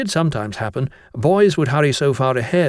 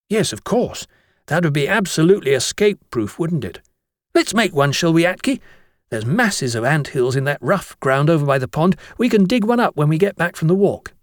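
put-on higher, excited boyish voice; enthusiastic schoolboy; 4 semitones higher than usual. Bromwich eagerly agrees to build the ant trap, higher boyish voice. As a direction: get carried away with the plan.

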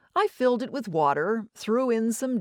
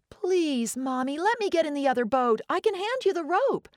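put-on pleading child; 9 semitones higher than usual. Child begging her mother.

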